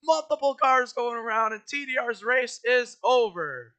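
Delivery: excited crash call, high and loud; motorsport commentator. Acted. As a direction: shout the big crash live. Live race crash call.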